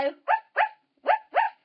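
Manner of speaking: high, loud little woofs; short squeaky pretend barking; dog barks. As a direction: yap like a small dog. Pretends to be a dingo.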